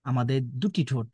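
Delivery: usual speaking voice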